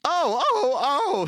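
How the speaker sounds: whiny trucks moaning; groaning trucks. A narrator's reading. Trucks complaining as James backs down, wailing.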